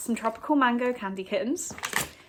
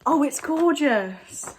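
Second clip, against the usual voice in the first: slow, higher, breathless wonder; awed; 6 semitones higher, 4 dB louder, slower than usual. Real, not acted. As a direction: marvel at something beautiful. Admiring gift.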